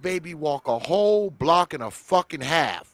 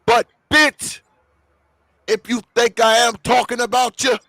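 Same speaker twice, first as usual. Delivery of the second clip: pointed callout; 8 semitones higher, 7 dB louder than usual. Real, not acted. Calling out careless mothers.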